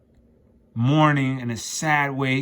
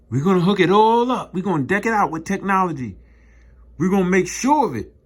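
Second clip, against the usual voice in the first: louder, boastful promise in a mock voice; 6 semitones higher, 6 dB louder, faster than usual. Mocking Edom's builders, louder and boastful.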